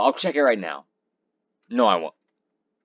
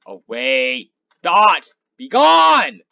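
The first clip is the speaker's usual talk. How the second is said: loud, high banishing cry; dramatic command; 8 semitones higher, 14 dB louder, much slower than usual. Real, not acted. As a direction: cast it away like a wizard. Banishing a mob.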